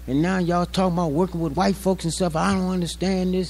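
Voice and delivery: imitating a complaining preacher, a touch mocking; mock arguing preacher. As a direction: mimic the preacher railing. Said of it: "and he said".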